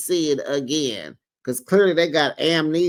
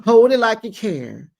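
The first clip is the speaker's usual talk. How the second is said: higher and louder, pressing on hold; pleading mock demand; 7 semitones higher, 5 dB louder than usual. Retelling Quad begging Simone to hold her hand, louder plea. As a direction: push the plea up a notch. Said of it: "So Quad is like".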